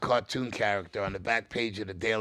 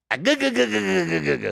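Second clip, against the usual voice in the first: Popeye laugh; 7 semitones higher, 4 dB louder than usual. Acted. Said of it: "I sound like him, too".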